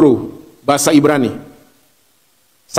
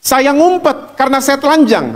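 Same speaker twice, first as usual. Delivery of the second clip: lightly acted Adam, pitched up; jawaban Adam; 8 semitones higher than usual. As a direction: answer in a higher voice, confessing. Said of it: "Adam jawabnya apa". (suara Adam, nada tinggi)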